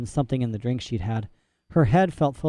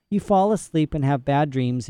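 ordinary delivery, nothing marked

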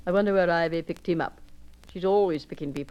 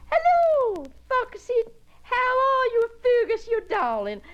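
much higher, louder, thrilled hellos; delighted greeting; 3 dB louder, slower than usual. Acted. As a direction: squeal out a welcome to dear old friends. Greeting old friends with delight.

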